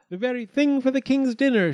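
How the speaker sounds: high, loud storybook exclamation; excited cook. A narrator's reading. Cook spotting the chick for dinner, delighted.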